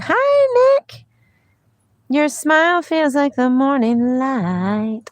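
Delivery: very high, bright hello; sing-song greeting. Real, not acted. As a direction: greet the viewer in a chirpy lilt. Greeting a chat viewer.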